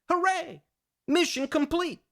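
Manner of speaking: very high, toy-like celebration; high-pitched token triumph; mock victory cheer. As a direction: pipe it up in a tiny cheery voice.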